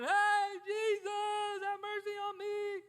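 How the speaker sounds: high, loud, hollering cry for mercy; imitated yelling. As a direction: belt it out like a beggar calling to Jesus over the crowd. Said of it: "you were yelling, you're hollering".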